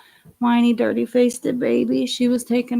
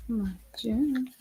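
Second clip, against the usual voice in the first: whispering; 2 semitones higher, 10 dB quieter than usual. Real, not acted. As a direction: coax her softly under your breath. Mother coaxing baby in whisper.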